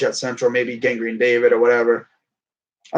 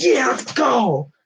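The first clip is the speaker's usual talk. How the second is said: slow, drawn-out mock cheer, pitched up; retold cheer; 8 semitones higher, much slower than usual. Fan recalling a big hit on the quarterback, slow drawn cheer. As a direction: stretch out a happy "let's go". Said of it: "I was like".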